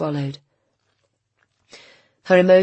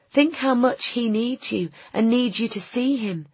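much higher, pleading woman's voice; urging woman; 8 semitones higher than usual. A narrator's reading. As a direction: appeal to his love for the child. Nina urges Marek.

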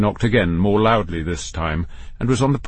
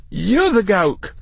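high, slow, affronted comeback; indignant retort; 11 semitones higher, slower than usual. A narrator's reading. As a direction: take offence and stretch the words. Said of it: "the voice retorted".

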